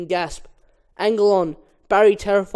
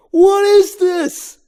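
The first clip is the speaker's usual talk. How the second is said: very high, loud, slow wail; bewildered cry; 13 semitones higher, 11 dB louder, much slower than usual. Acted. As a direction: stretch it out, reeling. Barry dizzy from G-forces.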